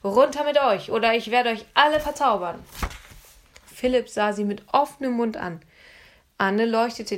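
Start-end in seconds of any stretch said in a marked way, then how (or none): 0.0-2.6 mock sorceress threat. A narrator's reading. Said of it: "sagte Anne und wedelte mit der Taschenlampe".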